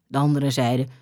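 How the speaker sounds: plain reading voice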